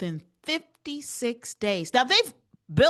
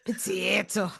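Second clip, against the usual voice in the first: softer "potato." with an Irish "pa-tee-to"; mock Irish accent; 4 semitones lower, 4 dB quieter than usual. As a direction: murmur "potato." in a stage Irish voice. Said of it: "So ridiculous and bad".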